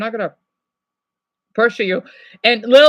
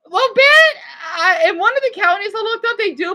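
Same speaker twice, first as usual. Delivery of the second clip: higher read-out voice; 10 semitones higher, 5 dB louder than usual. Retold or imitated. Reading a viewer's message.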